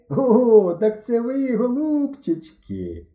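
high, loud gloating welcome; mockingly delighted discovery; spitefully gleeful. A narrator's reading. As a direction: gloat over them, stretching the last word. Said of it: "розплився у єхидно радісній посмішці Книж".

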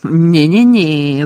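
slow, loud, drawn-out objection; заперечення Белатриси. A narrator's reading. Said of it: "заперечила Белатриса". (протяжне гучне заперечення)

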